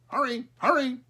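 high, loud squawky crow call. A narrator's reading. Crow calls the barnyard animals, high squawky cry. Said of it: "he called out".

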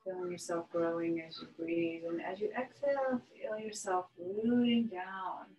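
calm yoga breathing guidance with long pauses; unhurried meditation voice, letting each phrase settle; guided meditation. Real, not acted. Yoga teacher settling students into breath and relaxation, guided meditation.

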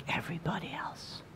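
dropped to a whisper; hushed. Real, not acted. Preacher questioning believers who blend in, hushed whisper.

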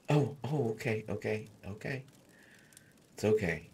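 ordinary talk

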